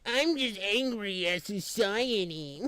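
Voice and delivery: slow, mopey mock of a sulking critic; slowly mimicking a sulking leftist critic; mocking whiny voice. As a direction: drag it out like a sulky kid. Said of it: "you're over here like".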